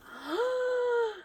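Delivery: sharp high gasp of delight; a sudden in-breath, high pitched, at a find; surprised gasp. Retold or imitated. Spots something in booth.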